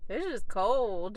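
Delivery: talking while brushing teeth; words round a toothbrush. Real, not acted. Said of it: "Sorry, I'm brushing my teeth".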